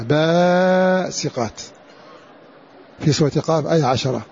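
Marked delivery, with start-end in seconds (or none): 0.0-1.6 "باسقات" with the first long aa held out; elongated first alif. Shown as a demonstration. Teacher showing students the first alif is kept, "باسقات" with a drawn-out first aa. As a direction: stretch the opening vowel of "باسقات", baaa-siqat. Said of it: "إثبات الألف الأولى منها".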